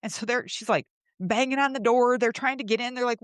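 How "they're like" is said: ordinary delivery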